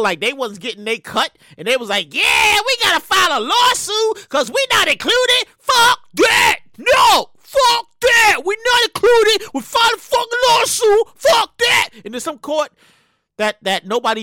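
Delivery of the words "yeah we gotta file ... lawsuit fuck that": loud, high-pitched mock protest; outraged complainers. People left out of relief announce a lawsuit, mock-outraged shouting. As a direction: rant like an indignant mob, hammed up. Mimics people angry at exclusion.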